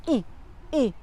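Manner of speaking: "إي" as a short i after a glottal stop; kasra sound. Shown as a demonstration. Teacher isolating the first sound of Inaam, "إي" as short i. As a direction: say "إي" as a short, separate hamza-plus-i sound. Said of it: "لاحظوا الكسرة هنا".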